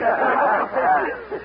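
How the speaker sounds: high hearty laugh; a big amused laugh at the cook's joke. Acted.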